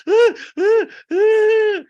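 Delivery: loud, very high drawn-out gasps; hammed-up cries, each longer; mock wailing gasps. Mimics self in theater with girl.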